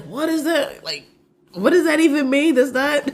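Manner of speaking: high-pitched mock bewilderment, amused; imitating baffled husband. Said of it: "and he's like".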